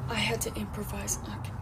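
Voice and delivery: hushed confession; whispered aside. Acted. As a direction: whisper it to the camera. Aside after the door.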